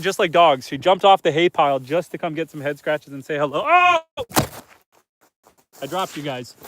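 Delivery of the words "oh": sudden high startled yelp; real startled oh, high and loud; surprised. Real, not acted. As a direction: caught off guard, jump up in pitch. Goat jumps off hay pile.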